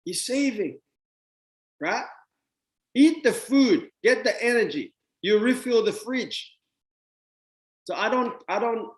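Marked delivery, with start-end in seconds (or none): 2.9-6.6 high, loud command to eat; raised insistent instruction; emphatic loud urging. Real, not acted. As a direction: push them to act now.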